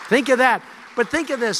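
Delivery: loud emphatic repeat. Real, not acted. Rally repetition for effect.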